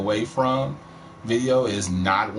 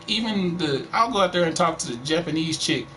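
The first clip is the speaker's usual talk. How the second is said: ordinary talk, nothing marked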